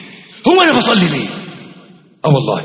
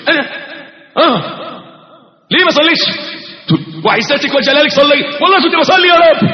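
high, raised, frightened protest; startled pleading; 8 semitones higher than usual. Retold or imitated. A startled servant pleading with God on Judgment Day, frightened and raised. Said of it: "تتخض كده، تتفزع".

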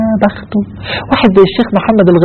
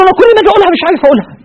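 much higher and very fast, panicked; panicked dying man; 3 dB louder, much faster than usual. Retold or imitated. Dying passenger panicking that he cannot say it, fast and high. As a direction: blurt it out in helpless panic. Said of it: "قال له".

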